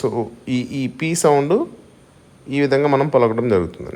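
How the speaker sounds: ordinary delivery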